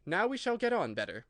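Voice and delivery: Alice thinking to herself; musing Alice. A narrator's reading.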